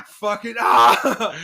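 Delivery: loud, high, drawn-out groan-yell; hammed-up wordless rage at the end; mock exasperated outburst. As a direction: imitate boiling over with frustration. Imitates mate exploding when teased.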